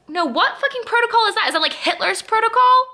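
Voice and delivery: high, indignant outburst; incredulous, furious rhetorical questions; outraged. Real, not acted. Outraged rant about airline.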